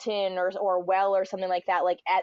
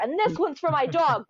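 mock taunt; 7 semitones higher than usual. Mock revenge taunt in story.

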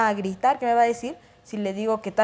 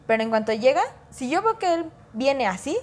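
ordinary talk, nothing marked

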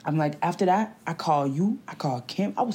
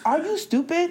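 incredulous; 10 semitones higher than usual. Real, not acted. Repeats outburst.

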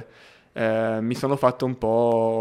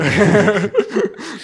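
high burst of laughter; 9 semitones higher, 8 dB louder than usual. Real, not acted. Laughing at the name.

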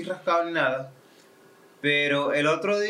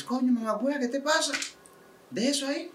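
mock Chilean scolding; 9 semitones higher, 3 dB quieter than usual. Imitating a Chilean couple quarrel.

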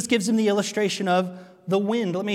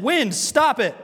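a mock order to the wind, a bit louder; commanding the wind; 5 semitones higher, 5 dB louder, slower than usual. Preacher pretending to order the wind, mock command. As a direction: bark it at the storm, half joking.

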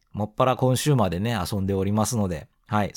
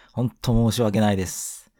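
ordinary talk, nothing marked

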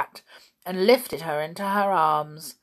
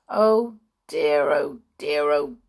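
groaning; 3 semitones lower, 6 dB louder, slower than usual. A narrator's reading. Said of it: "groaned Binky".

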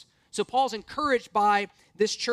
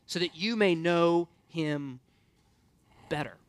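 slow, lowered, every word weighed; slow emphasis; 4 semitones lower than usual. Shown as a demonstration. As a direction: linger on know him better, slowly.

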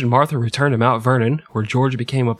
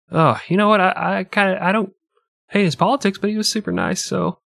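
mock tipsy voter; 8 semitones higher than usual. Drunk voter talking himself round.

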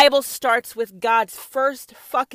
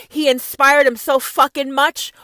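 usual speaking voice

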